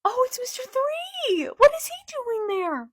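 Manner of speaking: high-pitched, loud squeal of surprise; excited squeal. Real, not acted. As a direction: squeal with delight at the reveal. Sudden excited reaction while reading.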